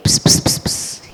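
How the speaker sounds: conspiratorial unvoiced psst psst; whispered psst. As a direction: hiss psst like sharing a secret. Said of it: "He whispers".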